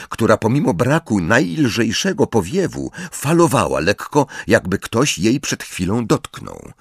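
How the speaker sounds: ordinary delivery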